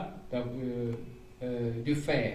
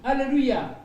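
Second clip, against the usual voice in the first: joyful hallelujah; 7 semitones higher, 6 dB louder, faster than usual. Real, not acted. Preacher praising God.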